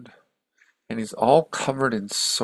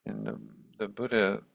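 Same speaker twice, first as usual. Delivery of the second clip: ordinary talk, nothing marked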